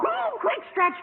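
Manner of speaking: high, urgent hushed call; urgent hurry. Acted. Student hurrying friend into closet, high and urgent. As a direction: rush them in a panic.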